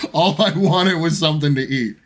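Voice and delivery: ordinary talk, nothing marked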